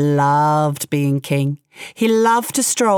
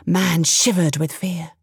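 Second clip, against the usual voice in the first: usual speaking voice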